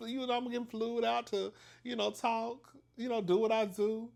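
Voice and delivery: put-on high-pitched, keen girlfriend chatter; excited high voice, lightly hammed-up; eager acted reply. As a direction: play up being game for the gig, pitched high. Acting eager reply to gig offer.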